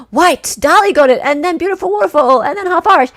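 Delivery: high, loud game-show reveal; gasp, then call out the winners brightly and loudly; excited announcement. Real, not acted. Quiz host revealing who got it, excited.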